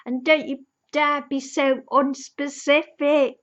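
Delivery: exasperated fan scolding; indignant scolding. Pretend. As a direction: tell the old lady off. Her outburst at the old lady.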